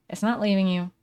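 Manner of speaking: normal talking voice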